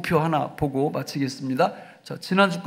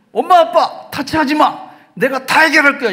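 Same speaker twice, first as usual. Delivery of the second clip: put-on high, loud little-kid outburst; preacher voicing a stubborn child at his parents; acted child; 7 semitones higher, 8 dB louder than usual. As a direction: play a small child yelling at mom and dad. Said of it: "이렇게 하죠".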